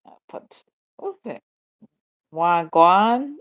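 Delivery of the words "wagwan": loud, questioning try at a Jamaican greeting; attempted Jamaican accent. Shown as a demonstration. Streamer attempting a greeting for Jamaican viewers, tentative Jamaican accent. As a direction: try out Jamaican, unsure, ending on a rise. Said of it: "wish I could talk Jamaican".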